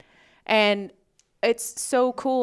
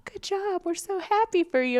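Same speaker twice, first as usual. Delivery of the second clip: much higher, lightly voiced gushing praise; a light mock of the girls' cheering; imitating cheery friends; 12 semitones higher than usual. Imitating the girls congratulating her, high and gushing. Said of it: "they're all like".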